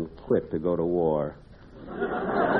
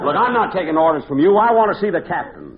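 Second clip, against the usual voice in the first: raised, higher-pitched, insistent protest; indignant defiance; 9 semitones higher, 5 dB louder than usual. Acted. Captive refusing the pirate mate's orders, indignant. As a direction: stand up to him, louder and higher.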